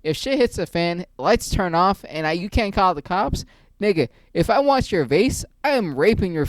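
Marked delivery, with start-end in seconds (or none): none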